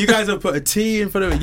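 high-pitched mock American; American accent, high and animated. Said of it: "that's an American accent".

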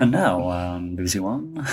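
a hint of a BBC One announcer. Host auditions as a BBC announcer, lightly voiced.

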